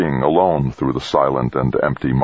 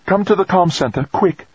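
urgent, much higher-pitched call; almost breathless call. A narrator's reading. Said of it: "she called, almost breathless".

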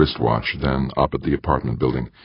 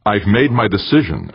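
higher-pitched, mildly snapped retort; 8 semitones higher, 4 dB louder than usual. A narrator's reading. Eberly overrules Kananga's objection, snapped. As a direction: cut off the objection with a snap. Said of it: "Eberly snapped".

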